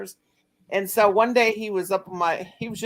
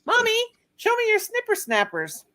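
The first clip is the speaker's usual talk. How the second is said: put-on much higher pleading toddler voice; insistent little boy. Toddler demanding to see mom's teeth, high pleading. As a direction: beg Mommy like a stubborn two-year-old. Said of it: "And he goes".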